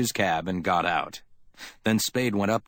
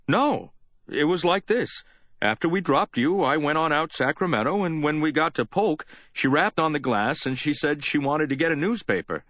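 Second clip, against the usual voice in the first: higher, plain-spoken working man's voice; cab driver; 7 semitones higher than usual. A narrator's reading. Chauffeur recounting the ride to Spade, easygoing. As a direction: tell it straight like a cabbie.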